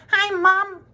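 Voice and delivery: put-on very high, mouse-like boy's voice; a tiny boy calling from inside the TV; tiny squeaky voice. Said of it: "A tiny little voice, no louder than the squeaking of a mouse".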